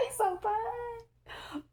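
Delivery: very high, slow, breathless swoon; squealing swoon. Real, not acted. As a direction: melt over him, almost crying with delight. Swooning over the fine man.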